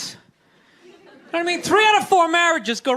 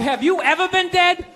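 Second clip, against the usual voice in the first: ordinary talk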